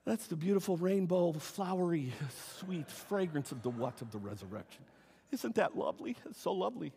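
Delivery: as if to prove he isn't angry, softly and sweetly; deliberately soft, sweet tone. Pretend. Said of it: "I'll try to change the tone of my voice".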